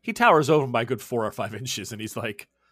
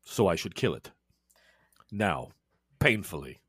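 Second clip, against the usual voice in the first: low, soft, measured threat of a vampire; low menacing vampire; 6 semitones lower, 4 dB quieter than usual. Acted. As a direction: speak low and cold as a killer vampire. Edmundo offers to kill.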